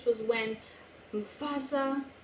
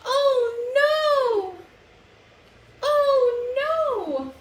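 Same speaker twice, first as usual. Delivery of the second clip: much higher and louder, wailing it out; call-and-response cry; 10 semitones higher, 8 dB louder, much slower than usual. Shown as a demonstration. Reader leading children in an exaggerated wail. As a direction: lead the kids in a big wail. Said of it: "kids, can we all say".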